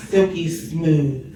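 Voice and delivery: slow, soft late-night DJ purr; smooth radio voice. Pretend. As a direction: roll the name out like a late-night radio host. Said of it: "I feel like I have to say it like that".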